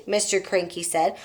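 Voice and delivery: plain reading voice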